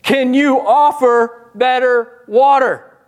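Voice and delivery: a touch of a skeptical woman at the well. Retold or imitated. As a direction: challenge him, doubting. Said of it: "She said".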